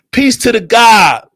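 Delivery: high, slow, shouted salute; loud hailing. Real, not acted. Speaker saluting the God, loud high hail. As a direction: call it out loud and drawn out. Hails someone, then repeats it subdued.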